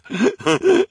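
shrill little laugh of delight; high giddy laugh. A narrator's reading. Reporter giggles at her headline, giddy laugh. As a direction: giggle with glee.

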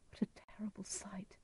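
very soft, whispered horror; hushed dismay. A narrator's reading. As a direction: whisper it as an aghast aside. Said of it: "cried Edgar".

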